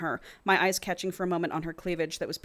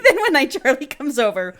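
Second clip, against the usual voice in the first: laughing, high and loud restart; 7 semitones higher, 11 dB louder than usual. Real, not acted. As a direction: push through the giggles. Restarts line laughing, much higher.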